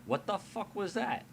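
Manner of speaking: high-pitched startled question; startled surprise. Acted. Willow reacting to a sudden arrival, startled. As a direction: jump in surprise, pitch leaping up. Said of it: "jump a little bit in surprise".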